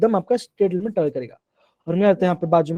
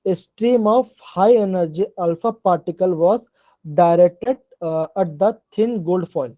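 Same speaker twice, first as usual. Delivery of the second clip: slow dictation; much slower than usual. Shown as a demonstration. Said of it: "Rutherford ने क्या कहता है".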